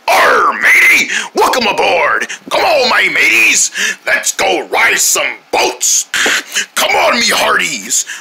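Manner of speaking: rally the crew like a pirate captain; boisterous pirate welcome aboard. Acted. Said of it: "I'm like a pirate".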